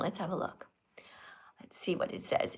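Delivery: soft unvoiced whisper. Real, not acted. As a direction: whisper to yourself while reading. Checking comments.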